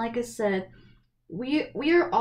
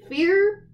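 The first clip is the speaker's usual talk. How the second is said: "Fear" repeated much higher and louder, drawn out; dramatic stress; 10 semitones higher, 8 dB louder than usual. Shown as a demonstration. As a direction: punch "Fear" high and loud, like a reveal.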